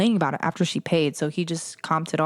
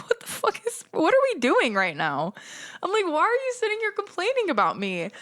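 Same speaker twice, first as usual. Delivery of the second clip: exasperated outburst; 11 semitones higher than usual. Retold or imitated. Venting about the complaining customer.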